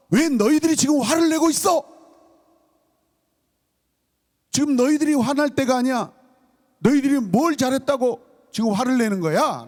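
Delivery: high-pitched indignant scolding, acted out; angry rebuke. Psalmist confronting the raging nations, in indignant rebuke. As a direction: demand an answer as an outraged accuser. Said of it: "지금 책망하는 거예요".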